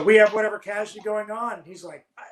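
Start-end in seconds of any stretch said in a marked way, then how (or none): none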